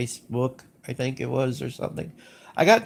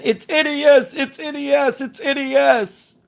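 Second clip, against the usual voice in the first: much higher, louder mocking whine; mocking Mike's freak-out; 13 semitones higher, 6 dB louder than usual. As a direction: ham up a friend losing it over letters. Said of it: "Mike flipped the fuck out".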